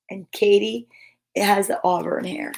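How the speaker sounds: usual speaking voice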